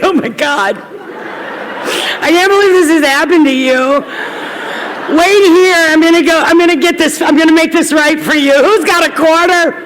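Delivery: high, loud, teary overwrought gush; gushing weepy self. Retold or imitated. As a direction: play your blubbering oversympathetic self. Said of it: "I was like".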